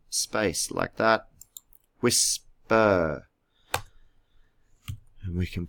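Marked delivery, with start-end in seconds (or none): 2.0-3.3 "Whisper" drawn out as whis... per; split into syllables. Shown as a demonstration. Teacher types the hyphen between syllables, "Whisper" said in two parts. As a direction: leave a clear gap inside "Whisper". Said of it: "That's two syllables".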